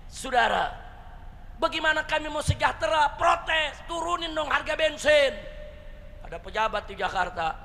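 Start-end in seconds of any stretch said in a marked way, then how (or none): 1.6-5.4 imitated demand, pitched higher; protesting. Ojek driver demanding cheaper fuel, protesting. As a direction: press the complaint in a raised voice. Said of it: "Nah, tukang ojek protes".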